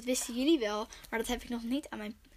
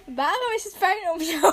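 higher, louder through giggles; giggling; 7 semitones higher, 6 dB louder than usual. Real, not acted. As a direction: read it while cracking up. Reading question while giggling.

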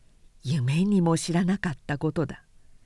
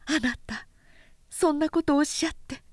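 flustered wife; 6 semitones higher, faster than usual. Acted. Wife protests to husband.

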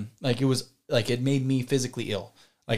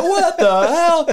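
much higher, louder and slow, stunned disbelief; astonished exclamation; 9 dB louder, much slower than usual. Retold or imitated. Amazed as the doctor pulls out yet more food. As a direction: draw it out in total disbelief.

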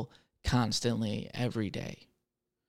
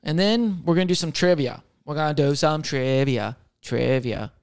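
normal talking voice

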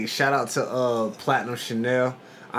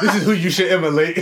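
laughing; 8 semitones higher, 8 dB louder than usual. Real, not acted. Joking about ex-boyfriend.

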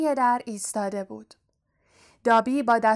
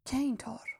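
«که اینطور؟» said much softer, falling at the end; quiet; 3 semitones higher, 10 dB quieter than usual. A narrator's reading. The angry visitor quietly answering the headmaster, hushed. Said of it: "آهسته به دامبلدور گفت".

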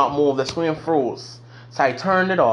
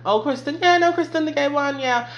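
high gossipy classmates; lightly voiced gossiping classmates; mock gossip voice; 11 semitones higher than usual. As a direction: imitate schoolmates gossiping, much higher.

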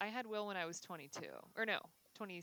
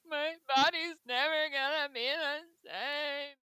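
crying; 6 semitones higher, 9 dB louder, slower than usual. Retold or imitated. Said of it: "bawling my eyes out".

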